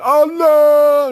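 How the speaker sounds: high, loud hammed-up "Oh no!"; Rackham Willie yelp. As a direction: squeal it like the guy in the clip. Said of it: "when he's going".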